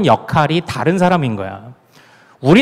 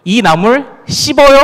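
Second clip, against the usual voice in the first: higher, louder Gyeongsang 씹어요 for bitter; Gyeongsang dialect question; 6 semitones higher, 4 dB louder than usual. Shown as a demonstration. Pastor imitating Gyeongsang in-laws asking if greens are bitter, Gyeongsang dialect. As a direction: punch it out the Gyeongsang way. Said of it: "이렇게 얘기하잖아".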